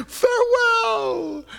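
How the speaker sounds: high, drawn-out farewell cry; calling farewell. Acted. The stew bidding diners goodbye, calling out high. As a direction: call it out long and very high. Dramatic farewell before being eaten.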